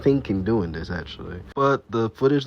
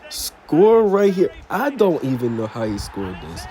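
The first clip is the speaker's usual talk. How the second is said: excited play-by-play; 3 semitones higher, 5 dB louder than usual. Acted. Narrating penalties and golden goals to viewers, excited play-by-play. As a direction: call the goals like a live commentator. Calls the simulated matches.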